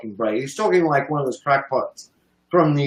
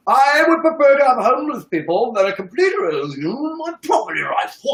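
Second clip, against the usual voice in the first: mocking the guy; 10 semitones higher than usual. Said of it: "So this guy saying".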